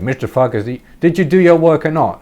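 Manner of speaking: mock the angry teacher's scolding; pissed-off teacher. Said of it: "the teacher came back all pissed off".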